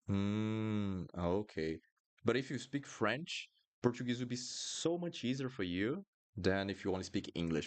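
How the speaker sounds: ordinary talk, nothing marked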